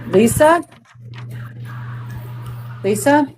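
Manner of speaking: high, rising call of a name. Real, not acted. Calling out for a viewer.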